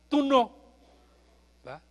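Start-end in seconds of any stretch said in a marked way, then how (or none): none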